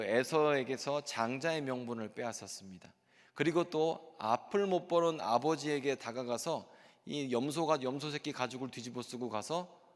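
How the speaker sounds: ordinary talk, nothing marked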